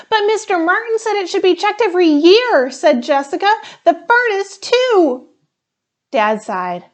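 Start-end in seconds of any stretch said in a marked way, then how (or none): 0.1-5.3 put-on higher, louder, fast anxious child voice; a kid pushing her dad to act; little girl pleading, high and quick; insistent worried girl. Jessica presses Dad.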